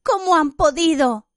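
high-pitched distressed question; gasping, choking question. A narrator's reading. Hermione repeats her shocked question, gasping. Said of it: "preguntó jadeando como si se ahogase".

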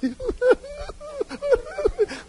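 high-pitched drawn-out mock sobbing; fake hypocritical wail. Congregant overdoing the crying with someone who mourns, fake wailing. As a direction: wail in exaggerated, put-on grief. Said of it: "Tampoco seas hipócrita".

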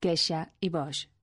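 x in quexa and box said as English sh; old Castilian quexa, box. Shown as a demonstration. Lecturer showing quexa and box with English S H, sh for x. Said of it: "con S H inglesa".

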